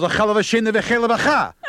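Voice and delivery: put-on high Yiddish-accented gibberish; Yiddish punchline. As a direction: deliver it like an uncle in Yiddish. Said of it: "punchline in Yiddish".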